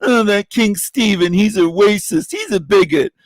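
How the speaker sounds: a hint of a clutching-pearls scold; mock prissy accuser. Mocking viewers calling him racist.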